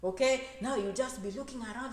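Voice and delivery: normal talking voice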